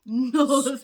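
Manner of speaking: loud laughing exclaim; emphatic notes. Real, not acted. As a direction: burst out the word with a laugh. Laughing about her many notes.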